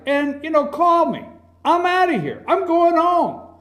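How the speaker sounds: high-pitched, emphatic retold walk-out; acted-out defiant goodbye; Ronald Reagan. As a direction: toss off the exit line up high. Acted quote of Reagan walking out.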